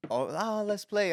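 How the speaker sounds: high, excited dog voice; eager playful dog. Retold or imitated. Dog inviting someone to play, eager. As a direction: pitch it way up like a pup wanting a game. Voicing eager friendly dogs.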